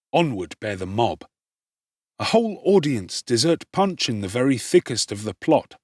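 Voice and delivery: plain reading voice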